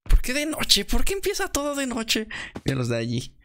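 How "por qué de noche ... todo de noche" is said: surprised. Real, not acted. Game starts at night, complaining.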